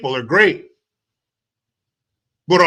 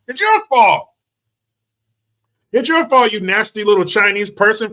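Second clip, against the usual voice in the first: mock accusation; 3 semitones higher than usual. Mocking officials blaming public.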